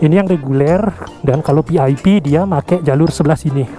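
ordinary talk, nothing marked

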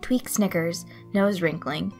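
usual speaking voice

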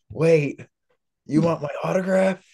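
high-pitched, disbelieving question; retold disbelief, pitch climbing through the question; incredulous. Retells disbelief at autograph request.

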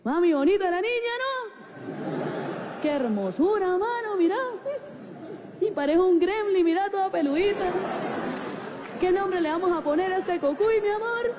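high cooing dad voice; tender, hammed-up high-pitched father; doting new dad. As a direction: gush over a newborn, voice lifted high. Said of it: "le decía a mi mamá como que".

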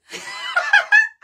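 real high burst of laughter. Real, not acted. Laughing at the fans' antics.

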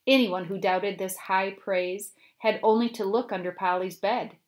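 ordinary delivery

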